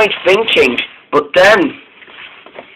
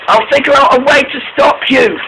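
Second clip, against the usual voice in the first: high-pitched, urgent promise; urgent reassurance; 10 semitones higher than usual. A narrator's reading. Molly promises runaway Caitlin help, urgent.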